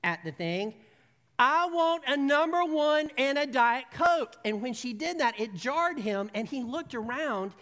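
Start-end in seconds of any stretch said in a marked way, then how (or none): 1.4-4.3 loud, high, slow shout; shouting the order. Retold or imitated. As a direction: bellow the order at the box. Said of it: "then she shouts".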